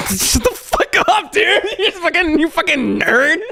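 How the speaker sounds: laughing hard while teasing; cracking up, voice jumping high. Real, not acted. Laughing at his old clip.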